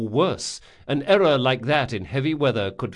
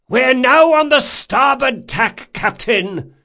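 high, loud report to the captain; shouted report; 13 semitones higher, 9 dB louder, slower than usual. A narrator's reading. Sailing master to captain, called out. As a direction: call it out across the deck.

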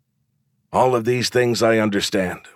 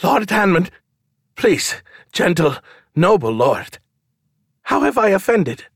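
high, pleading grovel; 11 semitones higher, 3 dB louder than usual. A narrator's reading. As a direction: simper and beg the lord. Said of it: "bowed low enough to kiss his own prick and said".

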